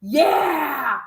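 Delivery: hammed-up cheering viewer; mock agreeing fan. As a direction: shout fawning agreement mockingly. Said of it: "how do these people ... just like".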